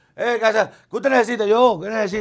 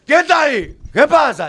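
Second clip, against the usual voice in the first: calling out; 8 semitones higher, slower than usual. Retold or imitated. Man checking a night noise, mock brave call. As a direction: challenge the intruder, voice pitched up.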